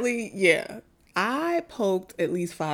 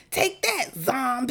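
put-on playful kid battle cry; 7 semitones higher, slower than usual. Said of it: "she said".

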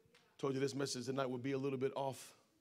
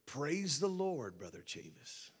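slow, drawn-out church greeting, dropping off; Brother Libby; slower than usual. Retold or imitated. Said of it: "And, you know, Brother Libby".

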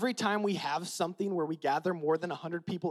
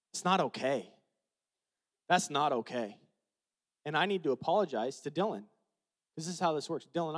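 usual speaking voice

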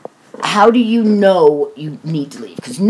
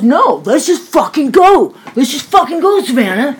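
higher, forceful mock shout of an impatient son; imitated angry yelling; 7 semitones higher, 4 dB louder than usual. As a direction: act out his fed-up outburst, pitched up. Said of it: "I'm not yelling like he was".

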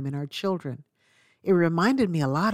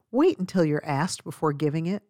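ordinary talk, nothing marked

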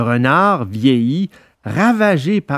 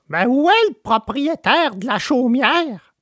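surprised; 9 semitones higher than usual. A narrator's reading. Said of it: "étonné".

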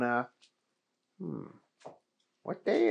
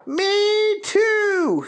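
very high, loud cowboy squeal; gleeful agreement; Keith voice; 7 dB louder than usual. Acted.

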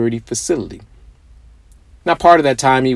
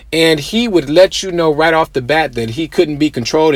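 ordinary talk

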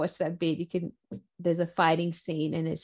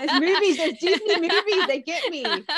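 laughing through the excuse, higher and louder; 10 semitones higher, 6 dB louder than usual. Real, not acted. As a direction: giggle it out, embarrassed.

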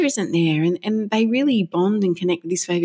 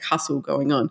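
ordinary delivery

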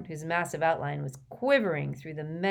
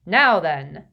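jovial professor; 11 dB louder than usual. A narrator's reading. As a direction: boom out instructions cheerfully. Slughorn's voice.

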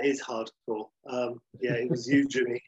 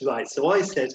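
usual speaking voice

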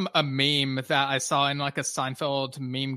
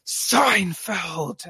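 higher-pitched, drawn-out cry of mock rage; mock enraged Newman; 5 semitones higher, 3 dB louder than usual. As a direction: exclaim it like an enraged sitcom rival. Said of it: "Newman gets enraged and is like".

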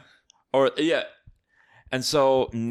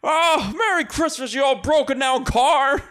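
high, shouted mock greeting; hammed-up overjoyed movie hero; jubilant George; 11 semitones higher, 4 dB louder than usual. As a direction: cry it out giddily to a beloved old wreck. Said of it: "And he's like".

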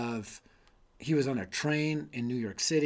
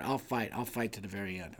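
quiet resolve; 5 semitones lower, 6 dB quieter than usual. Acted. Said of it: "I love this quote from him".

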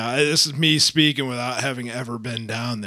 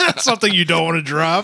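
ordinary talk

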